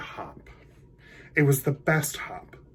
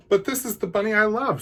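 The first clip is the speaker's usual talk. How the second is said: higher, fast earnest plea; bunny pleading; 10 semitones higher, 3 dB louder, faster than usual. Acted. Wesley answering the stink bug, higher and quick. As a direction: defend your love eagerly.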